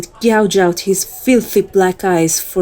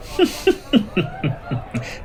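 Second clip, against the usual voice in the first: soft whispered laugh; 8 dB quieter than usual. Acted. Flirting after feeding.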